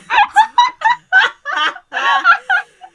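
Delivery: loud high burst of laughter; big laugh. Real, not acted. Amused at Hammond's plan, a loud high laugh. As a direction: crack up hard. Cracks up at own line.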